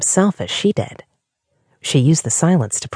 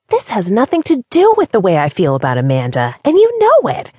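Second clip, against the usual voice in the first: building to a much higher, louder outburst; angry exclamation; 7 semitones higher, 4 dB louder than usual. A narrator's reading. Diana rejecting Kat's accusation about Amanda, angry. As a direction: snap back, stung and furious. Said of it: "Diana exclaimed angrily".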